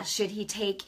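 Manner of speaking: ordinary delivery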